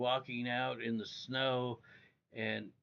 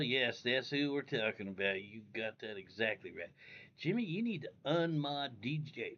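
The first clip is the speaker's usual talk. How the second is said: ordinary delivery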